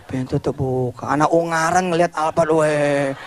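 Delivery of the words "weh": ordinary delivery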